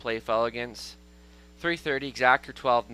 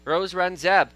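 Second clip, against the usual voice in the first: race call; 7 semitones higher, 8 dB louder than usual. Real, not acted. Race caller naming the winner.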